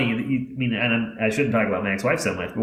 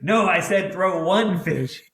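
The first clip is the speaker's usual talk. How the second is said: grandpa's reply; 7 semitones higher, slower than usual. Retold or imitated. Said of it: "And he goes".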